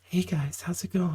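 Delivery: sweet phone-operator hello; customer service voice. Shown as a demonstration. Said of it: "do my customer service voice".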